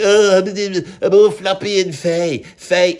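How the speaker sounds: mock fey, floppy whine; hammed-up feeble wailing. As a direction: put on a limp, mopey singer voice. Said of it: "[name] is that, isn't it?".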